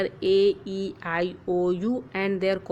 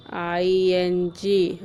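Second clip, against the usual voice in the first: slow, letters set apart; spelling out letters; 2 semitones lower, slower than usual. Shown as a demonstration. Teacher spelling the ing ending for children, slow. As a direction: spell the ending slowly and clearly. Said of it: "First eat".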